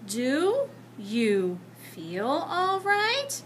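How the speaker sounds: very slow, drawn-out worried question; slowly. Acted. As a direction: let each word hang with concern. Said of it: "said Miss Plimsoll slowly".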